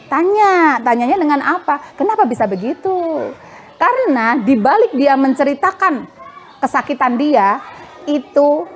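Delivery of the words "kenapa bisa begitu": put-on calm, patient question to the child; calm asking. Shown as a demonstration. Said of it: "tapi tanya".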